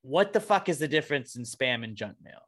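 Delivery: normal talking voice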